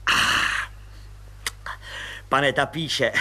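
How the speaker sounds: ordinary delivery, nothing marked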